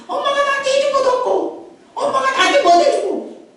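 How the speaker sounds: high-pitched, whining-style grievance; blaming complaint. Acted. Acting out people blaming mother.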